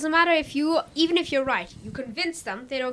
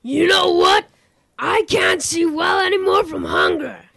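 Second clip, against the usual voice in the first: shouting, higher wolf voice; angry wolf; 5 semitones higher than usual. A narrator's reading. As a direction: bellow it out in hungry rage. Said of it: "said the wolf angry".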